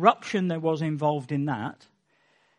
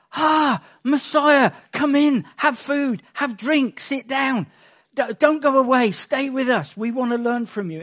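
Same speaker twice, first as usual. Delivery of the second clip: higher and louder, gushing welcome; mock welcoming host; 5 semitones higher, 4 dB louder than usual. Preacher imagining the priests welcoming the Messiah: gushing mock hospitality. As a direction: fawn over an honoured guest. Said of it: "instead of saying".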